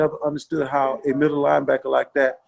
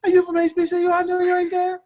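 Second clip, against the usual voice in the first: high, strained raspy taunting voice; David Little; 14 semitones higher than usual. Retold or imitated. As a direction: squeeze it up high and needle the rookie. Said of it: "and then he go".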